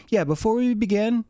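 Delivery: high-pitched dog lecturing its owner; talking dog. Acted. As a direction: complain in a high cartoon dog voice. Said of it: "Dog's like".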